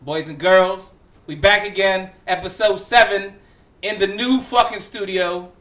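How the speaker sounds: loud, high announcer hype; hype podcast intro. Acted. Host opens episode seven to listeners, hyped announcer style. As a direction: open the show like a ring announcer.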